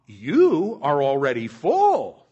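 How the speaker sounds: loud, slow, mock-admiring; sarcastic. Paul reading sarcastic praise to the Corinthians. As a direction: drip sarcasm over every word. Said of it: "are you catching the sarcasm here".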